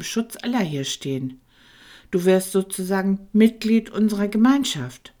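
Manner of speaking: lightly voiced Tom, musing; thinking aloud. A narrator's reading. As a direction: work it out as you speak. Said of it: "überlegte Tom laut". (Tom denkt laut nach)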